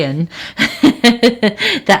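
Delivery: a laugh breaking into the words. Real, not acted. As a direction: giggle through the phrase. Laughs mid-phrase, then repeats.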